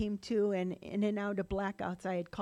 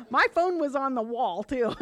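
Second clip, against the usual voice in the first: loud, higher, laughing aside; 5 semitones higher, 9 dB louder than usual. Real, not acted. Speaker joking to young people about old phones, laughing. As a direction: say it through a laugh, pitched up.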